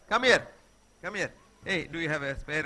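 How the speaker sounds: ordinary delivery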